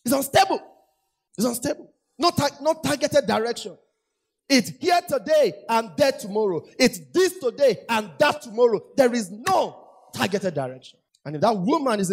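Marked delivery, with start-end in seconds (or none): 0.1-0.6 higher and louder, hammered home; ringing pulpit cry; preaching call. Acted. Preaching climax. 2.2-11.0 higher and louder, building to a shout; rising pulpit fervour; full-force preaching. Acted.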